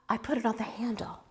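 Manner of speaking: a sudden unvoiced whisper. Real, not acted. To viewers about the mug handle, whispered. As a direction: breathe it like a little secret.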